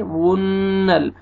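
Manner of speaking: "वुन्नल" with the doubled N held and hummed; humming, lengthened N in "वुन्नल"; ghunnah. Shown as a demonstration. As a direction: hold the nasal N in "वुन्नल". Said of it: "गुन्ना करेंगे".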